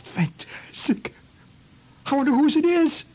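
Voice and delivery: slow hushed then high loud wonder; awestruck kid. Retold or imitated. Stunned kid wondering about the finger, hushed then loud. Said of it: "He said".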